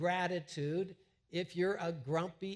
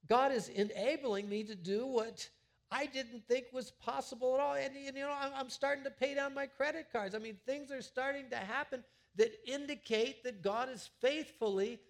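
excited testimony; 7 semitones higher than usual. Retold or imitated. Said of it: "you're saying".

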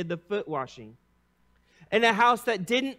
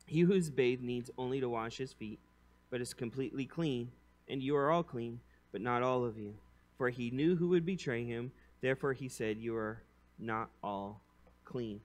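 softer, lower, grave and gentle; solemn Jesus; 6 semitones lower, 8 dB quieter than usual. Acted. Jesus gently telling disciples they're clean. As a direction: let it sink in quietly.

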